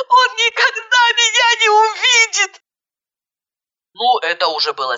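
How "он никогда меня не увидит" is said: high sobbing wail; tearful cry. A narrator's reading. Bride sobbing she will never be seen, high wail. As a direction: wail it high through tears.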